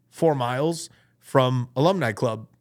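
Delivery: ordinary delivery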